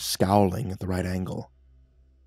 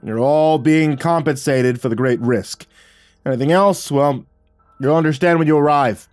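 commanding briefing voice; 6 semitones higher, 7 dB louder than usual. Acted. Briefing a hired team.

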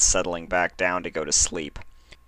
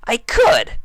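high, loud insistent I; insisting; 8 dB louder than usual. Acted. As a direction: press the point hard. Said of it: "Rainbow Dash insisted".

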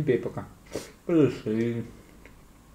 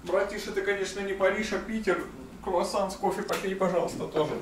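high comic voice; 9 semitones higher, 4 dB quieter than usual. Pretend. Joking advice to his brother.

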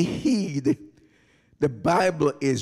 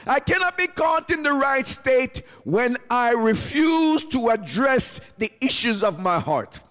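high, driving preacher's cadence; impassioned preaching; 6 semitones higher than usual. Real, not acted. Preacher voicing repentance to the Lord, fervent preaching. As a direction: pile up the resolutions in a high voice.

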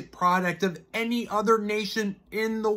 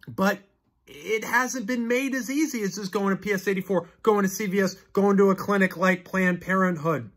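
ordinary talk

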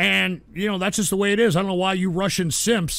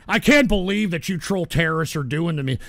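ordinary talk, nothing marked

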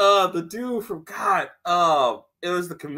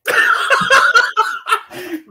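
high, loud burst of laughter; real loud laughter; 9 dB louder than usual. Real, not acted. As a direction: crack up loudly. Laughing at the scene.

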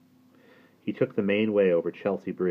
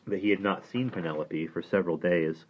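plain reading voice

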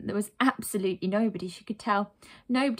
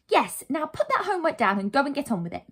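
higher, emphatic order; bossy urging; 6 semitones higher, 3 dB louder than usual. Acted. Narrator sends heroine off to save the town, bossy urging. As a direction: chivvy her along.

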